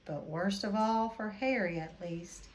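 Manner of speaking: ordinary delivery, nothing marked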